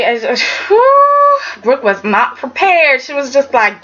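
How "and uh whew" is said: high, slow whew of disbelief; whistling whew. Real, not acted. Vlogger whews at Katie boxing Brooke, high and slow. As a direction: let out a long high whew. Reacting to the fight.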